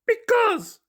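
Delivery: high, loud wailing monster voice; high puppet wail; crying Grover. Acted. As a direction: wail it out like a hurt little monster. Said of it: "cried Grover".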